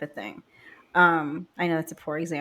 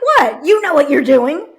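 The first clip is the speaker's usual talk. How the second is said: higher and louder through laughter; laughing; 7 semitones higher, 11 dB louder than usual. Real, not acted. Retorting to husband, laughing and louder. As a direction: protest while laughing.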